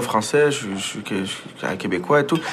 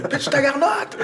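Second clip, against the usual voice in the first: much higher, slow, exaggerated Québécois shout with a laugh; Québécois slang yell; slower than usual. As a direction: belt out joual slang at recess, laughing. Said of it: "la première fois que j'ai crié ça".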